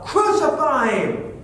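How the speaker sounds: high, loud, slow drawn-out yell; crowd's shout. Retold or imitated. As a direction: roar it out as the mob demanding death. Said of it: "they shouted all the more".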